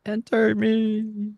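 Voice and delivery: high, slow seductive whisper-tease; teasing chart voice. Pretend. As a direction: tempt them to enter, drawn out.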